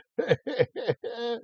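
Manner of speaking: high-pitched giggle; amused laugh. Real, not acted. Reacting to a funny number, high amused laugh. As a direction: laugh in a high, quick burst.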